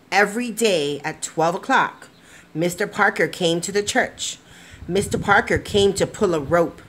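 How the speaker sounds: gentle, expressive storybook narration; lively picture-book reading to kids; reading to children. A narrator's reading. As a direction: read it like bedtime story time. Reads picture book aloud.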